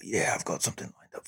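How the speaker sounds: breathy quick take on Robbie; light imitation of Robbie, fast and soft; Robbie impression. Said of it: "That's my best Robbie accent".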